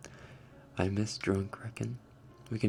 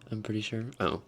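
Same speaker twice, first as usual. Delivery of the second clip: normal talking voice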